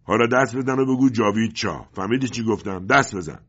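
loud, high bullying command; shouting. A narrator's reading. Agent orders father to clap and chant, shouting. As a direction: yell at him to obey. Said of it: "فریاد زد".

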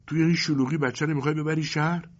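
nagging mother. Acted. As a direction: press him like a fed-up wife. Mother alarmed. (مادری غرغرو با صدای بلندتر و زیرتر)

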